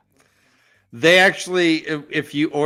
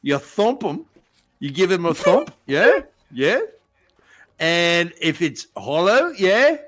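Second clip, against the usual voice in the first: put-on broad Aussie with rising tag questions; Bondi Australian accent. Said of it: "Bondi B".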